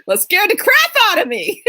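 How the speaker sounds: high, squealing laugh through the words. Real, not acted. Woman recalling the scare from the tailgater, laughing. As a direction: tell it through real laughter. Laughs while telling her fright.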